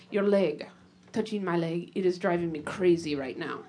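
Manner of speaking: lightly voiced low foreign lover, deadpan; Stefan's accent. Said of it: "he says it exactly like that".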